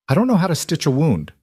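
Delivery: high, fast panic; panicked. Acted. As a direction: blurt it out in fear. Lila panicking.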